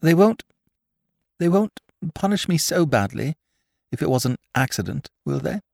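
high, halting, anxious plea; falter, fearful of punishment; quivering voice. A narrator's reading. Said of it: "There was a quiver in the voice".

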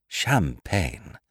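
plain reading voice

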